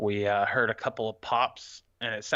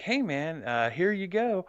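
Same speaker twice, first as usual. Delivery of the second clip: higher-pitched, feigned nonchalance; imitated breezy greeting, pitched up; acted casual handoff; 8 semitones higher than usual. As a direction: play it innocent, lifting the pitch. Acts out busted dude's casual handoff.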